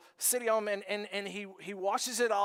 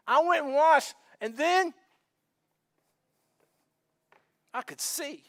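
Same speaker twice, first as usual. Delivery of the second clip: excited testimony; 5 semitones higher, 6 dB louder than usual. Retold or imitated. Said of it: "he's like".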